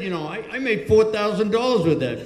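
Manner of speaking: high-pitched, protesting boast; retold indignant defense. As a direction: imitate a singer defending his act against a blunt critic. Retold singer defends himself.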